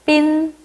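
빈 repeated on a high, level tone; model pronunciation. Shown as a demonstration. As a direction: say 빈 high and even for learners. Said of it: "읽어볼게요".

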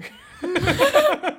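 big loud laugh; loud burst of laughter. Real, not acted.

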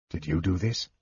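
low, soft murmured question; hushed and wondering. A narrator's reading. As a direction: ask it under your breath. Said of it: "Tanis murmured".

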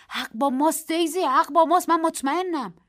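high, loud conviction; insistent. A narrator's reading. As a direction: insist with passion. Narrator insists they are right. (با اصرار و پرشور)

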